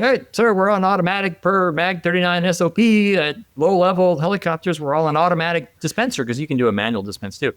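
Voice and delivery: mock yell to a superior; retold yelling. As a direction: re-enact yelling an explanation over a running engine. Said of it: "So I'm yelling".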